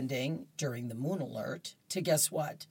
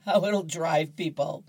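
usual speaking voice

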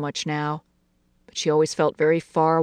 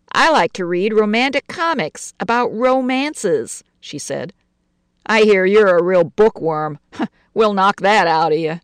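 gangster's moll; 4 semitones higher, 6 dB louder than usual. Acted. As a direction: sneer at the bookworm. Betty mocks Lucy.

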